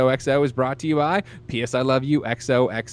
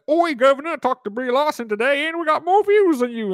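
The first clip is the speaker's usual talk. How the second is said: high, hammed-up pompous British accent, much higher; 11 semitones higher than usual. Host mocking a rival's gloating text, hammed-up high British accent. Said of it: "pompous British".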